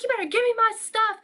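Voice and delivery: high-pitched, worked-up demand; customer freaking out. Retold or imitated. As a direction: imitate an irate customer demanding, pitch jumping up. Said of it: "like, starts freaking out".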